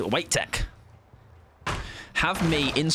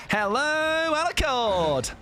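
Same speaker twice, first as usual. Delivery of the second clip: high, drawn-out silly call; goofy falsetto-like hail; playful high greeting; 13 semitones higher, much slower than usual. Pretend. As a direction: sing it out high, stretching vowels. Silly greeting to game character.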